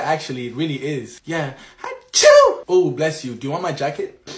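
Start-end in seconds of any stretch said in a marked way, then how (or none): 1.8-2.6 high, loud fake sneeze. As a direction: blast out a sneeze.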